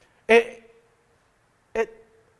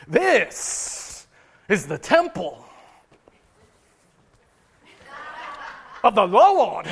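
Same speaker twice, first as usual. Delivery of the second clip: hammed-up televangelist, long dramatic pauses, loud final words; mock TV evangelist milking every phrase, stretched out; emphatic TV preacher; 5 dB louder, slower than usual. Shown as a demonstration. Preacher parodying TBN brothers and sisters, mock emphatic. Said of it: "maybe they get real emph-, emphatic about it".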